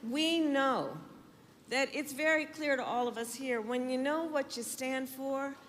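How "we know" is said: loud, rousing campaign oratory; rally speech. Real, not acted. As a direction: rouse the crowd, building to a cheer.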